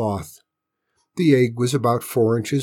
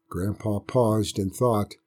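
ordinary delivery, nothing marked

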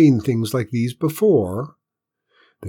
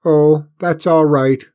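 alien voice; 5 semitones higher, 7 dB louder than usual. A narrator's reading. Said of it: "the alien told him".

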